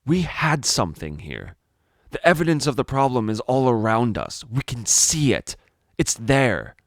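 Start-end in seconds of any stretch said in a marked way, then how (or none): none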